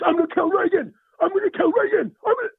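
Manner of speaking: high-pitched Rusty outburst; ranting yell. Acted. Rusty raging about hating Reagan, high-pitched rant. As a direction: rant it in a high, yelling character voice.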